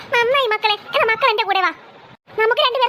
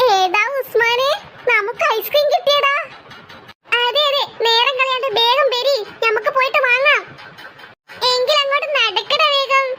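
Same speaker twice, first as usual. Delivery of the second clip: slow, stretched-out cartoonish calling; excited child characters; slower than usual. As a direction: draw the words out in gleeful play-acting. Children cheer over promised ice cream. (വാക്കുകൾ നീട്ടി സന്തോഷത്തോടെ)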